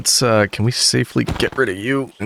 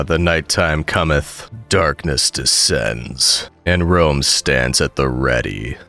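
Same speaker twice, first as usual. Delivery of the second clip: low, slow epic narration; dramatic narrator; 5 semitones lower, slower than usual. Pretend. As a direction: intone it like an epic saga. Mock epic night narration.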